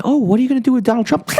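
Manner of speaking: high, fast squeaky questioner; mocking reporter. Reporter in Japan questioning DeSantis, high and fast. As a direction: rattle it off like a silly reporter. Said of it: "They ask him a question".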